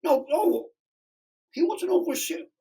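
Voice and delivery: mock the kid in a high sarcastic voice; mocking mobster. Mob guys mocking him.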